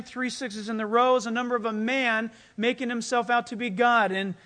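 normal talking voice

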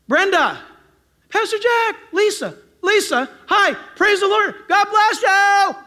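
high, hollered church-folk hellos; loud greetings. Acted. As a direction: call across the store to friends. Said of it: "Christians are loud people".

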